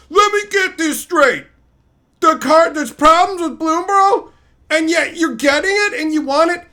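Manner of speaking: high, loud mocking whine of a complainer; mocking Magoo commenter. Streamer mocking a complaining commenter, loud high whine. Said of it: "Magoos complaining going".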